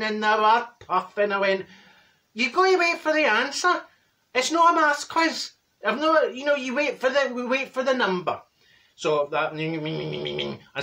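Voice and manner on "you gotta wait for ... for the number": high, indignant mock scolding; pitched-up, hammed-up exasperation; exasperated telling-off. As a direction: put the impatient players in their place. Scolding impatient bingo players.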